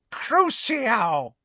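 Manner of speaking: high, drawn-out curse; Bellatrix. A narrator's reading. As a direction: cast the spell with villainous relish, high-pitched. Bellatrix casts a torture curse.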